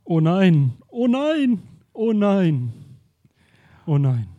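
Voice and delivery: mock dismay, loud, with a wavering oh nein; horrified outcry. Curator discovering the stolen painting, hammed-up dismay. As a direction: cry out in shock at the empty wall. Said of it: "entweicht dem drahtigen Mann ein entsetzter Aufschrei".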